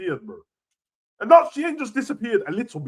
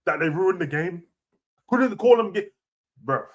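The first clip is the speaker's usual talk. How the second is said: normal talking voice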